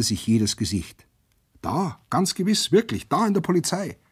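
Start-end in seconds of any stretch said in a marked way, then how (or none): 1.6-4.0 high-pitched, eager astonishment; astonished excitement. A narrator's reading. Prisoners press round the newcomer about the news, excited. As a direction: crowd abuzz at startling news, voiced high.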